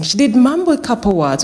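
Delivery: usual speaking voice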